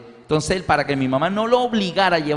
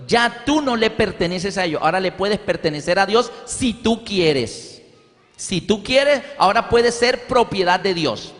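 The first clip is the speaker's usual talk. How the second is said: preaching; 4 semitones higher than usual. Acted. Preacher telling the congregation they now belong to God, full preaching. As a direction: drive it home from the pulpit.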